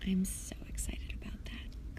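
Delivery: excited whisper. Real, not acted. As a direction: whisper it eagerly. Whispered aside.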